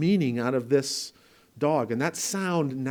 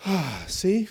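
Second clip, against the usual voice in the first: mock gasp, a little higher; hurt kid; 4 semitones higher than usual. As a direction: gasp like a kid proved right. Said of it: "they went".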